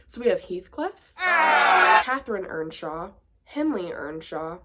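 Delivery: ordinary delivery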